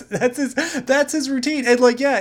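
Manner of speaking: usual speaking voice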